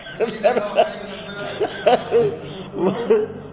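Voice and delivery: amused laughter. Real, not acted. Amused at the foolish compiler.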